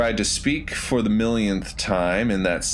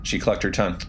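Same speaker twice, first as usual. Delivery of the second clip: plain reading voice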